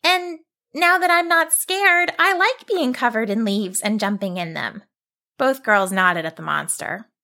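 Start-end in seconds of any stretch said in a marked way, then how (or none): 0.0-4.8 put-on young girl voice.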